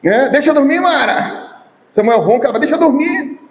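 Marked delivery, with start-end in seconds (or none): 0.3-1.4 groggy higher-pitched whine; rudely awakened. Retold or imitated. Husband protests being woken. 2.6-3.4 sleepy higher-pitched plea; rudely awakened. Retold or imitated. Samuel protests being woken.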